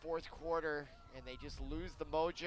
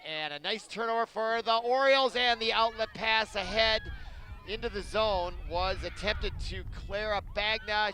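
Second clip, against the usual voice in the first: higher, louder turnover call; excited play-by-play; 4 semitones higher, 5 dB louder than usual. Acted. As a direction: call the turnover with rising energy.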